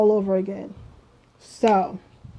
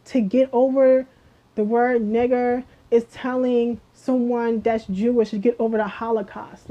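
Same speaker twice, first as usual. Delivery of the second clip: normal talking voice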